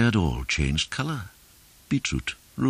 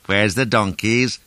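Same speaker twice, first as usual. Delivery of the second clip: raised, louder cry; character's called question; 6 semitones higher, 6 dB louder than usual. A narrator's reading. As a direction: call it out, pitched up. Weasley calls out at the river.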